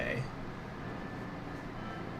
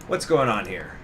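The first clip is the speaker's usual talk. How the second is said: normal talking voice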